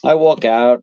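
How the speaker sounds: ordinary talk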